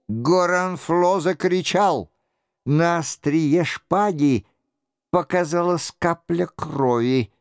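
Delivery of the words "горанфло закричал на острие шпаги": ordinary delivery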